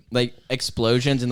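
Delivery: normal talking voice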